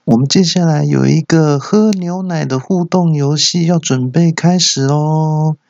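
high announcer-style hostess; high, sing-out mic announcement; hostess on microphone. Acted. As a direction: announce it like a club hostess, stretching the ending. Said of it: "那个小姐用麦克风说".

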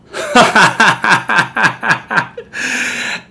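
loud delighted laugh; loud high laugh. Real, not acted. Host bursts out laughing.

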